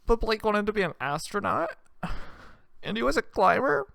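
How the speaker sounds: put-on high, wailing teenage girl; whiny teen daughter. Daughter wailing to her dad about Blake, high and whiny. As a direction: sob it out like a heartbroken teenager. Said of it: "she's like".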